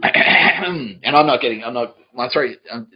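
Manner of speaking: loud start, settling as it goes; worked up, stumbling over the denial; getting angry. Real, not acted. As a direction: protest heatedly, then ease off into admitting it. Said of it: "I'm getting angry".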